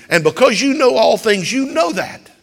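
preaching at full force; rising emphasis. Real, not acted. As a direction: build up to a loud confident peak.

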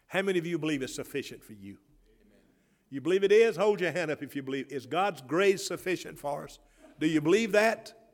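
high, loud call to the congregation; rally the church to believe; preaching at full force. Acted. Pastor pressing the congregation for an amen, loud preaching.

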